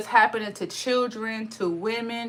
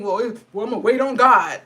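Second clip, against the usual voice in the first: mocking voice; 2 semitones lower than usual. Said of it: "people just saying".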